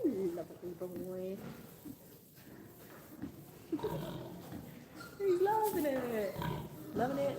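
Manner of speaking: slow, soft, swooping pet talk; cooing to pig. Real, not acted. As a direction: draw the words out as you fuss over an animal.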